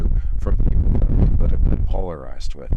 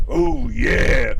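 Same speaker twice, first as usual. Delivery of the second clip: Randy Savage impression; 7 semitones higher than usual. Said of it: "his Randy Savage imitation".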